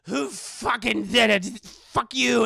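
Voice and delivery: put-on loud, slow furious drunken yell; drunk angry mom. Drunk mom yelling at everyone over her burned house. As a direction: rage like a drunk mother whose house burned. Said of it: "just drunk out of her mind".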